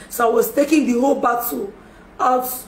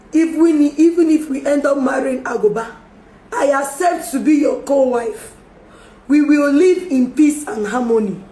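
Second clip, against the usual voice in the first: raised, pleading voice, louder; loud emotional plea; 3 semitones higher, 6 dB louder than usual. Real, not acted. Emotional public plea to rival.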